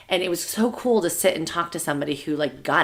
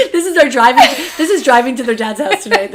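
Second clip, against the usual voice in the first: talking through laughter; laughing; 8 semitones higher, 11 dB louder than usual. Real, not acted. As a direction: laugh while explaining. Laughing while telling.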